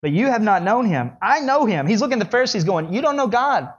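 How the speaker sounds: Jesus rebuking the Pharisees; bold declaration. Acted. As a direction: tell them to their face. Jesus confronting Pharisees, voiced.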